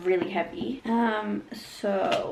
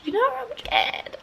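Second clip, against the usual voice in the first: playfully scared. Pretend. Playfully admitting fear of the book.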